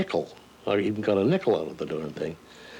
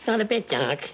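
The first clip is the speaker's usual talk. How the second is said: much higher cartoon rabbit voice with a laugh; Bugs Bunny; 11 semitones higher than usual. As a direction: toss it off cheekily as the wisecracking rabbit. Asked about voicing Bugs Bunny.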